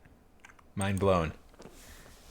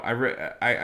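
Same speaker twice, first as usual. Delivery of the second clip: ordinary talk, nothing marked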